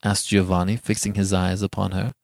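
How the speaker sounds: plain reading voice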